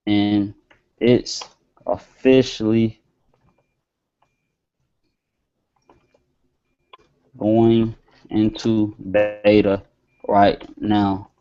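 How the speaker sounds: slow and halting, word by word as you type; slow email dictation. Shown as a demonstration. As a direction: dictate each phrase slowly while typing. Dictates email while typing.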